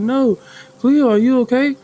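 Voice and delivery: high-pitched worried call; acted concern. Ella running up to check on Cleo, acted concern. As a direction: raise the pitch as if rushing up to check on a friend.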